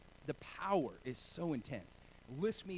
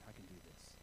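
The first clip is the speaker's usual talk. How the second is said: muttered self-talk; 10 semitones lower, 17 dB quieter, faster than usual. Retold or imitated. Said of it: "I'm standing there going".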